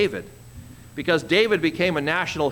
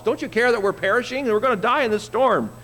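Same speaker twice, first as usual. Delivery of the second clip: panicked disciples; 6 semitones higher than usual. Retold or imitated.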